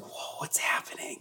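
whispered, awed mock reaction; hushed wonder. Guest imagines noticing everybody around them, hushed wonder. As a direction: whisper it as if quietly amazed.

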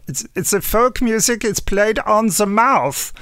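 put-on lightly done Scottish accent. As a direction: imitate a Scottish folk singer slowly. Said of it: "the Scottish folk singer, he said".

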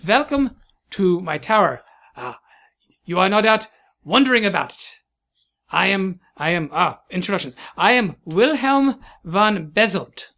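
put-on halting, swooping German-French accent. Scientist welcoming visitors to his tower, German-French accent. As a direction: introduce yourself grandly in a German-French accent. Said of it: "he switches to French".

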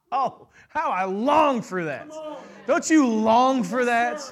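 yearning, drawn out on the last line; longing. Real, not acted. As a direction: let the longing pour out. Preacher voicing longing.

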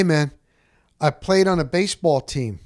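ordinary talk, nothing marked

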